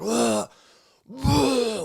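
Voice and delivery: high strained gagging retch sounds; loud mock gags, pitch jumping way up; retold gagging noises. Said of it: "making these crazy fucking noises".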